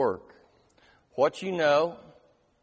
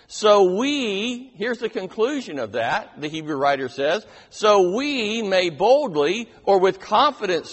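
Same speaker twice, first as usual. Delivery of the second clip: preaching climax; 5 semitones higher, 7 dB louder than usual. Acted. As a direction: proclaim it with rising confidence.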